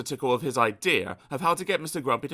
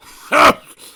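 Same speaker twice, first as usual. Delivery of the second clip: loud breathy grunt; huffy grunt; 17 dB louder than usual. Acted. Mister Grumpy grunting at Mister Happy's advice, loud and breathy. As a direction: huff in disgust. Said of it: "grunted Mister Grumpy".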